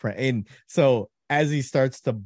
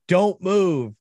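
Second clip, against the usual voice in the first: louder, higher mock command; retold urgent warning; 7 semitones higher, 5 dB louder than usual. Team radios the rookie during the prank, mock-urgent warning. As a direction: order him to freeze, acted out.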